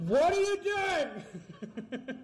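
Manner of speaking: slow, high stretched wail; drawn-out disbelief. Real, not acted. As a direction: stretch it out in horror. Character slipping during climb.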